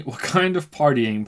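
laughing. Real, not acted. Laughing while reading the line.

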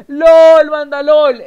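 very loud, high exclamation; stunned outcry. Real, not acted. Streamer telling viewers of shocking news, loudly amazed. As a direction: blurt it to everyone, completely floored.

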